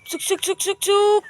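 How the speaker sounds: high, loud pigeon call with a short scold. Real, not acted. Owner calling his pigeons while warning a pest, high and loud. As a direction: coax the birds down, then snap at the thrower.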